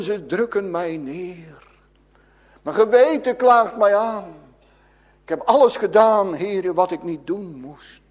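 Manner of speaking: usual speaking voice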